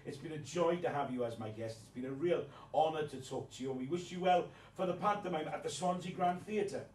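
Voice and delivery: ordinary talk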